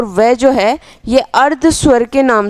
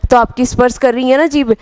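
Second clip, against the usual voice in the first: ordinary talk, nothing marked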